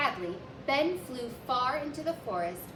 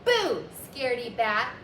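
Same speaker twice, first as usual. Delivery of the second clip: loud triumphant shout; shouting after; 8 dB louder than usual. A narrator's reading. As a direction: call it after him gloatingly. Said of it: "Ben shouted after him".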